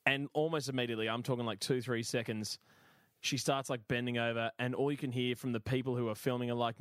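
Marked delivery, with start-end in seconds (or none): none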